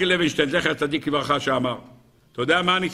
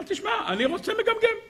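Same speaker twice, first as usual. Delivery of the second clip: a sudden falsetto jab as God; playful high mock of God's answer; 13 semitones higher, 4 dB quieter than usual. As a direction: squeak it out much higher and softer. Preacher voices God mockingly.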